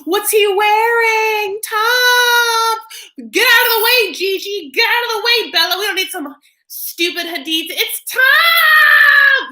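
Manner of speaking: shrieking high, loud starstruck fans; high squealing mob of fashion fans yelling for Tom; fangirl screaming. Retold or imitated. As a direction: scream his name like a crazed fan. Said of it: "Everyone's like".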